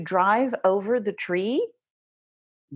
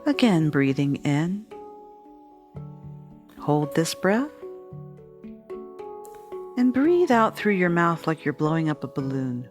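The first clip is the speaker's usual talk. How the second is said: calm, steady meditation guide; guided meditation; 2 semitones lower than usual. Acted. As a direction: lead them gently through the breathing. Leads a meditation.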